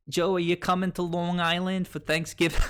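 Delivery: put-on aunts' thick Long Island accent, pitched high; imitating the Long Island relatives' accent. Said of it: "I grew up with a pretty thick Long Island accent".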